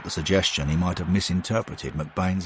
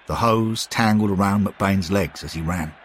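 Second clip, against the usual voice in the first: usual speaking voice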